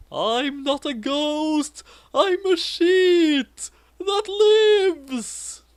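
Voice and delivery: high, very slow, drawn-out ghostly voice; a silly floating ghost, stretching it out. Retold or imitated.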